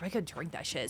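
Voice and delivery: normal talking voice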